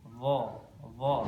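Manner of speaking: "Dha" with the voiced th flowing; heavy, unblocked th in "Dha"; sound released. Shown as a demonstration. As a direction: let the voice run on "Dha". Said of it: "suaranya terlepas".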